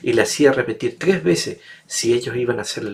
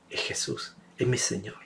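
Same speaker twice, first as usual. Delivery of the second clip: hushed, softer, breathless recognition; awed whisper; 9 dB quieter than usual. Acted. Peter recognizes his Lord on shore, hushed whisper. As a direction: whisper it like seeing a miracle.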